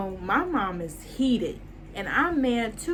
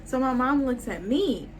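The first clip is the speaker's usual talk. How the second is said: ordinary delivery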